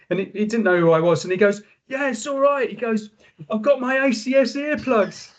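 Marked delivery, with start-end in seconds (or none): 1.9-2.7 very high mock-shouted reply; retold club yell, voice way up; imitating shouting. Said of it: "we were shouting at each other". 3.5-5.3 high mock yell over the music; imitating shouting. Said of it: "we were shouting at each other".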